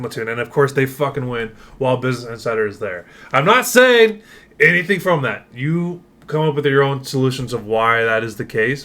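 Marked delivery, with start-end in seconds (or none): 3.3-5.5 I'm not saying, high and loud; loud mock innocence. Real, not acted. Sarcastic hint about cheating.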